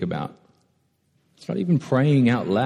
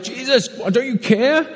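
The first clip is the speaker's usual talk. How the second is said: desperate plea; 4 dB louder, slower than usual. Retold or imitated. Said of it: "They're like".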